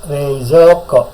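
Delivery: Reizouko drawn out slowly, each syllable; slow model word. Shown as a demonstration. Teacher models Reizouko slowly for students to copy. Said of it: "Dengarkan dulu".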